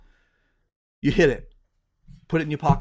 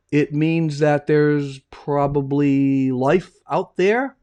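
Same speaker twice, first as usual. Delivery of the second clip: very slow, drawn-out Watson; slow musing; 2 semitones lower, much slower than usual. Acted. Watson guessing at life out there, very slow. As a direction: stretch it out thoughtfully.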